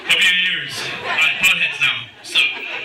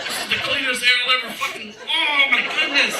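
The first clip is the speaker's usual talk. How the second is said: much higher, savouring the fresh air; delighted gasp; 9 semitones higher, slower than usual. Retold or imitated. Stepping out of the car into Maine air, higher and delighted. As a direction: breathe it in with delight. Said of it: "I'm like".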